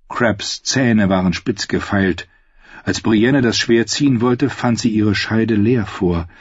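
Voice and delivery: usual speaking voice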